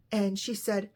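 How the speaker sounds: plain reading voice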